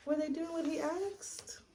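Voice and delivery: put-on whispered question to the child. Shown as a demonstration. Storyteller asks the child in a whisper. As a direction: lean in and whisper it. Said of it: "asks the listening child".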